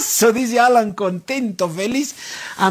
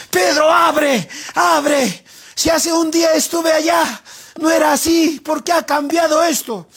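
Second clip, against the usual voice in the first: high-pitched, urgent pleading; mock desperation; 8 semitones higher than usual. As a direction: beg to be let out, acting desperate. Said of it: "Alan se desespera y le dice". (súplica desesperada fingida)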